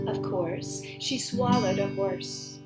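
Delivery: ordinary delivery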